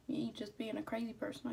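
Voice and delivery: ordinary talk, nothing marked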